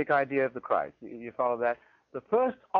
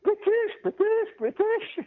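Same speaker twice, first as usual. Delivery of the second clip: high, indignant mock cry of British; hammed-up snobbish lady pushing to the front; squeaky, huffy imitation of an irate traveller; 3 dB louder, slower than usual. Irate lady marching to queue front.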